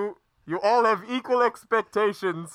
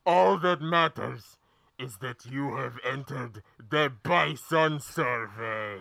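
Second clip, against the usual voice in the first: ominous hooded stranger; 6 semitones lower than usual. Acted. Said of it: "This voice".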